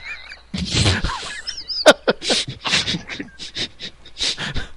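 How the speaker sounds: long high, breathless laughing fit; helpless wheeze of laughter; real high wheezy laughter; wheezing laugh. Real, not acted. Cracking up at being called fuckwit.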